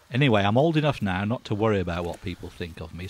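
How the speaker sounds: ordinary delivery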